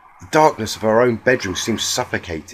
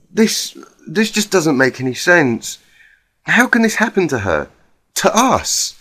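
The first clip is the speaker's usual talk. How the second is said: Twilight, shaken; 8 semitones higher, 5 dB louder than usual. A narrator's reading. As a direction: ask in dismay, almost breaking.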